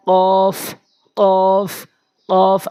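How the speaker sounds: "কফ" held long on a level tone; model letter recitation. Shown as a demonstration. Teacher has learners repeat along, long level "কফ". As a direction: draw out "কফ" for learners to follow. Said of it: "আমার সাথে উচ্চারণ করতে থাকুন".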